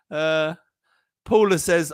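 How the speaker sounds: ordinary talk, nothing marked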